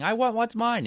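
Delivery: high, loud mock demand; demanding old man. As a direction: act out the old man fighting for his share. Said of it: "He's saying".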